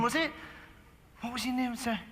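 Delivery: ordinary talk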